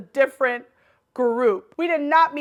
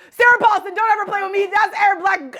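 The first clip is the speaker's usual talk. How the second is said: high, loud, emphatic outburst; excited insistence; 7 semitones higher, 4 dB louder, faster than usual. Real, not acted. Mock scolding on recognising a favourite.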